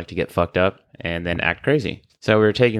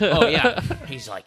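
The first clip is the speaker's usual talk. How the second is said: normal talking voice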